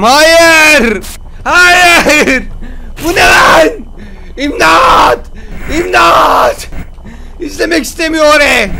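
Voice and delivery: high, loud panicked cries for help; panicked shouting. Real, not acted. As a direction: scream for help in mock terror. Jump scare, calling for help. (panik içinde bağırma)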